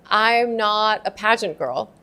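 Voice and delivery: usual speaking voice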